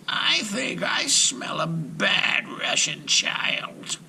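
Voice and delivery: high, slow, whispery witch voice; witch snarl. A narrator's reading. Baba Yaga sniffing for a child, slow whispered witch voice. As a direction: hiss it like an old witch sniffing out prey. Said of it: "and snarled".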